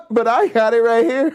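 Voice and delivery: high-pitched, laughing through the words; giggly mock bragging; laughing boast. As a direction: crack up while showing off. Cracks up boasting of bootleg tape.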